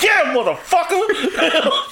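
hammed-up coach celebrating; imitating Kyle. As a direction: play Shanahan gloating at the final kill. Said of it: "Kyle went".

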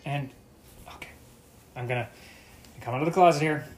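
ordinary talk, nothing marked

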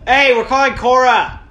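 loud shout to the other room; calling out. Real, not acted. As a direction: call out, loud and eager. Calling out to friend.

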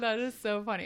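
ordinary talk

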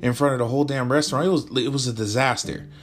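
indignant venting. Acted. Venting about a date.

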